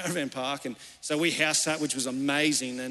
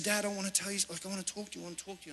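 softly retold, trailing almost to nothing; hushed child confiding; 2 semitones higher, 9 dB quieter, much faster than usual. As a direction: voice dropping to very soft. Small daughter confiding in dad.

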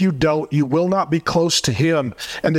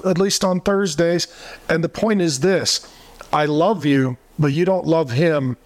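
normal talking voice

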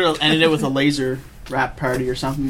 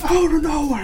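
high, excited commentator yell; Jerry Lawler; 12 semitones higher than usual. Retold or imitated. Joking about the fight like wrestling, high commentator call. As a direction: shout it like a ringside wrestling commentator. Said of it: "Jerry Lawler in the background".

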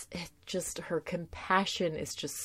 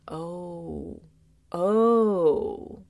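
dawning realization; 3 dB louder than usual. Retold or imitated. Tara realizing Spike hurts Buffy, stunned. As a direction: let it land, then hit it.

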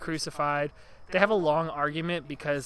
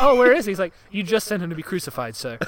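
much higher, loud surprised official; mock Roman exchange; 4 semitones higher, 9 dB louder, faster than usual. Pontius asking the soldier where Brian is, surprised high voice. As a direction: gasp it out in surprise. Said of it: "And he's like".

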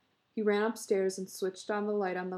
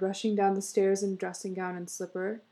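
ordinary delivery, nothing marked

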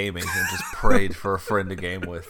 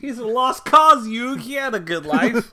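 squeaky callous sidekick; 13 semitones higher, 9 dB louder than usual. Retold or imitated. Friend joking as a callous character, squeaky high voice. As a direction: chirp it out like a heartless cartoon pal.

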